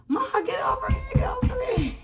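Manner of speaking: very high screaming call; a shrieked, frantic call; shout. Retold or imitated. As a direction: imitate the cousin yelling at her mom. Cousin yelling at mother.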